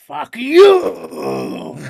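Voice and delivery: loud, high, drawn-out ranting yell; mock anime-style bellow; Japanese Alex Jones. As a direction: roar it like an anime ranter. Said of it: "Japanese Alex Jones all the time".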